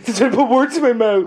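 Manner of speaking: high, loud laughing question. Real, not acted. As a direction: laugh while asking. Laughing at being scolded.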